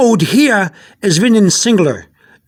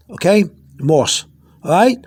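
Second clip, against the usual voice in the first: ordinary talk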